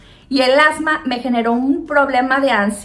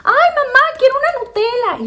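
put-on child begging; 12 semitones higher, faster than usual. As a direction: whine it like a small child asking mom. Child asking mother for Nutella.

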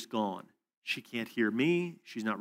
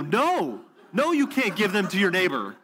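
higher, louder refusal breaking into a laugh; emphatic laughing no; 8 semitones higher, 6 dB louder than usual. Retold or imitated. To the woman wanting to pass idols to a neighbor, laughing loud refusal. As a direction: laugh while shutting the idea down hard.

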